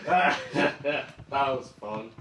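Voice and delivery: drawn out, dreamy and slow; slow dazed voice. Retold or imitated. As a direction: stretch each word lazily. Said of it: "Jackson's just kind of like".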